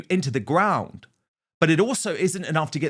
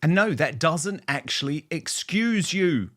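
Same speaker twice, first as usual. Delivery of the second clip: normal talking voice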